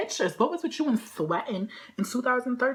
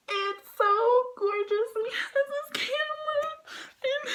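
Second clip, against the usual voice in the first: high gushing. Real, not acted.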